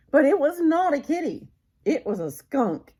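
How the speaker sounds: high, loud storybook twist; dramatic reveal. A narrator's reading. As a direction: announce the surprise with big drama.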